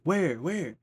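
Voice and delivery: high, loud teasing Where, Where; a friend hamming up fake alarm; mock alarm. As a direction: pretend to search the room in a jokey panic. Friend teasing, pretending to look.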